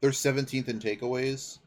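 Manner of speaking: ordinary delivery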